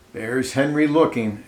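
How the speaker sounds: ordinary delivery, nothing marked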